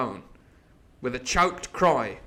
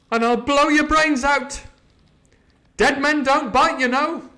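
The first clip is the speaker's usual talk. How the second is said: high, loud threat down the barrel; threatening shout; 12 semitones higher, 7 dB louder than usual. Acted. Jim threatening the coxswain from the mast, loud and high. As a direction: bark the warning up the rigging.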